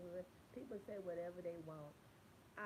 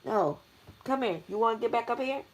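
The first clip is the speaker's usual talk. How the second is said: yelling; 6 semitones higher, 21 dB louder than usual. Real, not acted. Yelling at someone off-camera.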